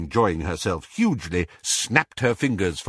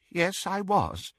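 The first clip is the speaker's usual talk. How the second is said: higher, quiet, unsteady admission; trembling; 9 semitones higher, 4 dB quieter than usual. A narrator's reading. Doge admitting he was at the funeral, trembling. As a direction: say it through trembling lips. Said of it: "said Doge through trembling lips".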